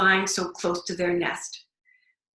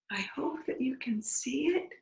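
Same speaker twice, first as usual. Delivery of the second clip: soft higher whisper; whispered hope; 5 semitones higher, 5 dB quieter than usual. Real, not acted. Aside to child while showing picture.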